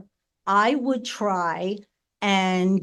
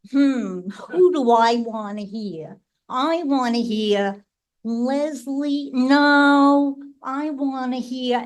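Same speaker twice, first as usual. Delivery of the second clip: put-on Brooklyn accent; 5 semitones higher, 5 dB louder than usual. Torturing big sister in the jukebox game, hammed-up Brooklyn accent. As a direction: ham up the Brooklyn accent to keep her waiting. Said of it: "in my best, like, Brooklyn voice".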